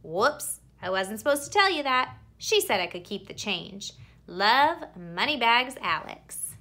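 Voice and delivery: plain reading voice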